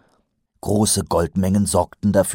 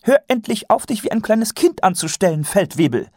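bossy lady; 4 dB louder than usual. Acted. Lady scolds the sergeant.

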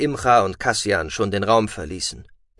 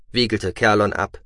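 ordinary delivery, nothing marked

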